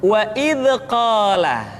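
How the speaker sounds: "wa id qala" with a little echo vowel after "id"; bounced dza, mistaken. Shown as a demonstration. Teacher showing the second mistake, bounced sound. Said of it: "seringkali dipantulkan".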